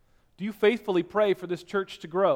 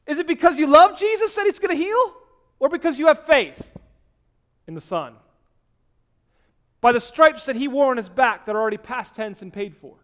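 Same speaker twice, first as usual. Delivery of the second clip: much higher and louder, ringing out; fiery preaching; 10 semitones higher, 11 dB louder than usual. Acted. Preacher challenging the church about faith in the Son, loud and high. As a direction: cry it out to the whole church.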